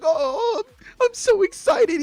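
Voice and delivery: high-pitched mock happy sobbing; hammed-up weepy excitement; crying happily. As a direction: squeal through put-on tears of joy. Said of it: "he's crying".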